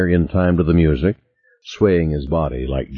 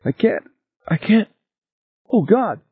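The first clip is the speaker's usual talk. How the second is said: high panicked gasping; choking on fear, barely breathing; hyperventilating. A narrator's reading. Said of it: "Edmonds's breathing was rapid".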